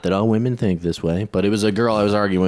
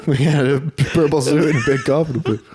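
laughing retelling; 5 semitones higher than usual. Retold or imitated. Retold punchline laughing.

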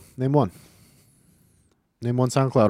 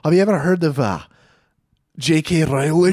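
put-on Irish, pitched up; higher, hammed-up Irish; Irish accent; 4 semitones higher, 3 dB louder than usual. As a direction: attempt a playwright's broad Irish accent. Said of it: "I gotta work on that accent".

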